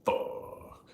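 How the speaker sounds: hushed frightened whisper; a scared, quiet curse. Retold or imitated. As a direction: breathe it out in fear, barely voiced.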